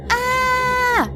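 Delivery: put-on boy yelling as his arm swells. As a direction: cry out in shock. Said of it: "yelled Raffy".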